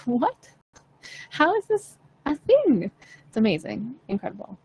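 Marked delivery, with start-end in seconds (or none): 0.1-2.9 high, slow incredulous question; retold disbelief. Coach recalling her shock at a student's calm, incredulous. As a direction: act out being stunned, pitch climbing high. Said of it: "I was like".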